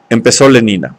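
ordinary delivery, nothing marked

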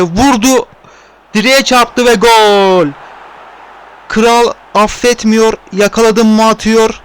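rising excited shout, stretched out; excited goal call. Real, not acted. Goal scored, commentator erupts.

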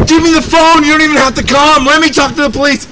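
normal talking voice

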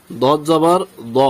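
drawn-out thick দ, no "aa"; heavy letter. Shown as a demonstration. Teacher spelling the next example, slow heavy rounded দ. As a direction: slowly end on দ as rounded "daw". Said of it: "এখানে বাংলাতে আকার উচ্চারণ করা হয় নাই".

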